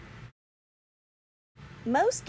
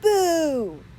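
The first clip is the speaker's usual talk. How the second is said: higher, louder spooky boo; ghost boo; 9 semitones higher, 5 dB louder than usual. A narrator's reading. Ghost scaring the boys with a loud boo. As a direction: jump out and shout it. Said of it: "yelled a ghost".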